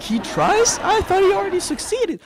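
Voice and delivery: mock disbelief, pitched high; incredulous. Audience reacting to his trying, mock incredulous. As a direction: squeak it up in exaggerated surprise.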